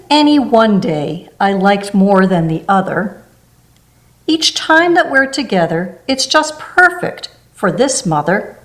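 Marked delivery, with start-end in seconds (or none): none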